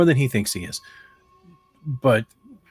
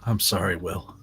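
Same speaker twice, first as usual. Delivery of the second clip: quiet muttering to self; 5 semitones lower, 5 dB quieter than usual. Acted. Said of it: "probably just sitting there and saying".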